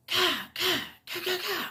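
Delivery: high, sharp "kah, kah" gunfire noises; quick high-pitched shots, two then three; imitated gunshots. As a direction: mimic a burst of gunfire with your voice. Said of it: "and I heard".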